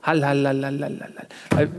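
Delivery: fading echo repeats; cave echo. Acted. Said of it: "Hall-Ball-Bundesliga wollten wir machen".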